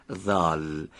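slow, voiced buzz on ذال. Shown as a demonstration. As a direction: say ذال with the vocal cords vibrating. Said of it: "تارهای صوتی رو به ارتعاش در بیاریم". (ذال را با ارتعاش تارهای صوتی بگو)

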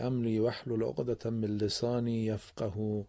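solemn recited prayer before the lesson; Quranic recitation. Acted. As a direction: recite the opening verses in a measured chant.

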